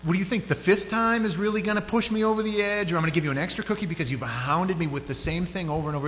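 high-pitched, worked-up parental rant; exasperated scolding. Acted. Dad hounded for an extra cookie, exasperated rant.

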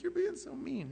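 high whiny mock complaint. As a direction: whine like a sulking child. Whining at the Lord.

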